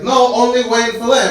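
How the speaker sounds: insistent shopkeeper. Retold or imitated. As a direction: act out an Arabic shopkeeper getting exasperated. Shopkeeper argues back in imitation.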